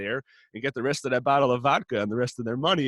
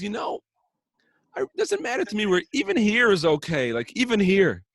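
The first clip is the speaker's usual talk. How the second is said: pitched up high, casually offering the spot; high sly nonchalance; 10 semitones higher, 6 dB louder than usual. Retold or imitated. Rebbe slyly telling the gravedigger, higher and falsely offhand. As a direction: toss it off lightly, faking indifference.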